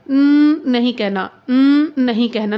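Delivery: "न" said firm and slow, not through the nose; avoid nasal n. Shown as a demonstration. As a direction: stretch out "न नहीं कहना" slowly, each sound clear. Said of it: "नाक में नहीं लेके जाना". ("न" को नाक से नहीं, धीरे और साफ़ बोलें)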